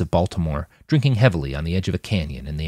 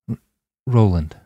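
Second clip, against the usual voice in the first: tearful. A narrator's reading. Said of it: "the ghost of tears beneath it".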